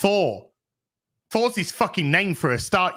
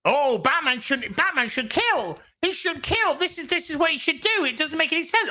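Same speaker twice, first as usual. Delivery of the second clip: much higher, whiny mock fan voice; 9 semitones higher than usual. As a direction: ape the fans demanding a killer Batman. Mocking fans wanting killer Batman.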